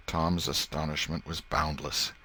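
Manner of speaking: usual speaking voice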